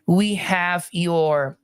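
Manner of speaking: We have your Q R S complex, drawn out slowly; slow emphasis. Shown as a demonstration. Teacher slowly naming the Q R S complex for students. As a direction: stretch out Q R S complex letter by letter. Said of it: "teaching wave names".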